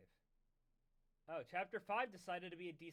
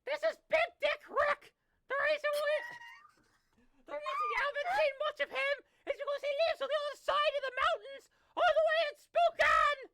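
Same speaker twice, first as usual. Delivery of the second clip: high, loud cheery mother; shrill mom voice; 14 dB louder than usual. Acted. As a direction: call him to the table. Mom introducing Rick.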